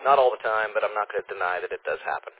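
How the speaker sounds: usual speaking voice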